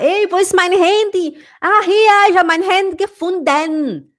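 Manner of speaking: high, excited sentence with "gefunden" ending in a full "denn"; full -den ending. Shown as a demonstration. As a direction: call it out high, overpronouncing the end of "gefunden". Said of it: "Em vez de você falar".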